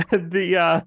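normal talking voice

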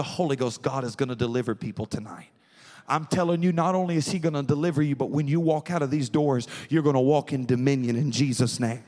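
fast declaring sermon cry; full-force preaching. Acted. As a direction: push the promise home. Altar-call push.

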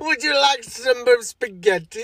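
high, slow cartoon Italian accent; mock Italian accent. Shown as a demonstration. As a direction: ham up an Italian accent, drawn out. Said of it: "racist Italian accent ever".

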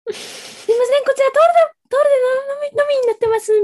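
high polite clerk voice; customer service voice. Retold or imitated. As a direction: play an apologetic barista. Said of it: "みたいに言われるから".

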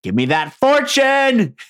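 high, drawn-out gleeful demand; hammed-up greedy wail on "fortune"; mock greedy cry. Wife craving the inheritance, mock greedy cry.

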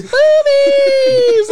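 high, drawn-out comic scream; screamed "boobies". Retold or imitated. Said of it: "just floating around screaming that shit".

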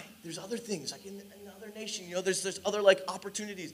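hushed, half-hearted objection; quiet protest. Retold or imitated. Preacher objecting privately to the Lord, quiet protest. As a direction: argue under your breath, very softly.

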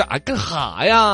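slow drawled Northeastern Chinese; 东北口音; Northeastern twang. Retold or imitated. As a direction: mimic a Dongbei buddy, slowly. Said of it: "你跟东北的朋友聊天".

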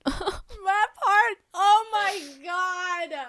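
high, drawn-out mock-crying wail; anguished wail. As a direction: wail over it like an overwhelmed fan, hammed-up. Moved by the sad scene.

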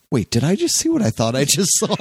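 high startled double take. Retold or imitated. Guard doing a double take at the elevator, startled. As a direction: play a guard's disbelief.

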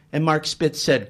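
usual speaking voice

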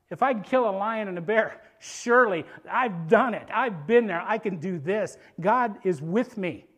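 loud, driven declaration; punched-out, emphatic assurance; confident insistence. Acted. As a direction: plead your case with full conviction. David insisting he can fight.

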